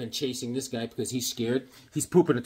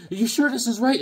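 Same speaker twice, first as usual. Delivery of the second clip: high, louder worried question; raised-pitch mock worry; doubting parent; 10 semitones higher, 6 dB louder than usual. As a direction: voice an anxious parent questioning the plan. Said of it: "And they're like".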